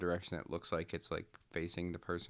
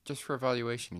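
mock doctor; 5 semitones higher than usual. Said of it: "the doctor's like".

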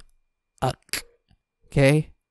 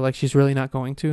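usual speaking voice

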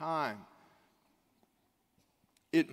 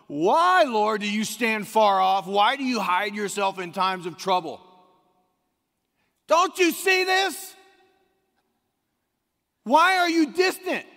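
loud, high pleading cry to God; anguished plea; 5 semitones higher, 8 dB louder than usual. Acted. Preacher crying out the psalmist's lament to God, anguished and loud.

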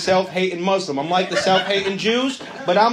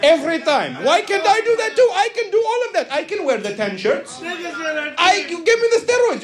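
high, hammed-up Indian accent, settling at the end; excitable Indian-accented haggler; 6 semitones higher than usual. Comic demanding steroids from their country, excitable accented rant. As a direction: argue heatedly in accent, then ease into a sales patter.

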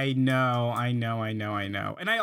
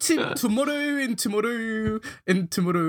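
higher, lilting mock Scottish accent; attempted Scottish accent; 7 semitones higher than usual. Podcast host showing friends how Scottish Macbeth sounds, rough Scottish accent. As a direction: have a shaky go at a Scottish accent. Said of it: "It's a Scottish accent".

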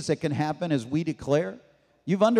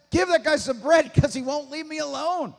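exasperated neighbor; 8 semitones higher than usual. Retold or imitated. Acting the pestered man relenting.

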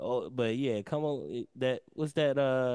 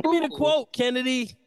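high-pitched insistent yell; 7 semitones higher than usual. Real, not acted. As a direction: demand it, pitched way up. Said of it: "Why you yelling?".

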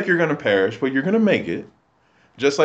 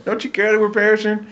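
higher, fast, frantic pleading; mock panicked disciples; 9 semitones higher, faster than usual. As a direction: rush it out like a panicked sailor, x1.5 pace. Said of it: "the disciples are freaking out".

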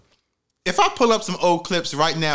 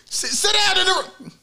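high-pitched imitation of a yelling mother; strained, much higher mock yell. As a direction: mimic mama screaming at the kids. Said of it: "she was yelling, telling me to sit".

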